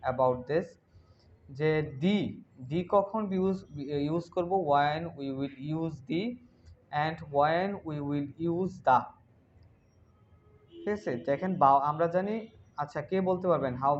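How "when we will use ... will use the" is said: slow, deliberate teacher phrasing; slow explanation. Shown as a demonstration. Teacher slowly posing the question to students. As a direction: drag each word so the class follows.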